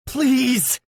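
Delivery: high, loud plea; begging. A narrator's reading. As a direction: beg him. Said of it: "Yuri begs".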